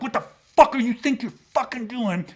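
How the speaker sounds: high, tight, compressed angry outburst; acted anger. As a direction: demand it angrily, short of breath. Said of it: "because I'm getting fucking angry".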